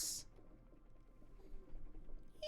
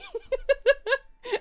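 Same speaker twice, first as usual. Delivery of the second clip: shrill character cackle, much higher; high cackling laugh; 7 dB louder than usual. Acted. Alligator cackles after yelling at Tony.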